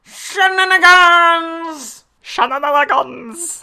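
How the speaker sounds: very loud, high, slow shout; drawn-out shout. Pretend. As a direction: stretch the word out yelling. Echoing the announcer joke.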